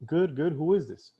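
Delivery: hint of another person on the line; lead answering. Voices the lead answering his call.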